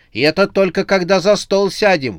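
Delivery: loud, high indignant retort. A narrator's reading. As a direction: snap it back, outraged. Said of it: "возмутилась она".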